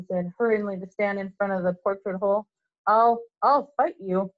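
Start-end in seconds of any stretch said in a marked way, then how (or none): 2.9-4.3 slow, halting, louder brave threat; scared kid forcing himself to fight; nervous stammering threat. A narrator's reading.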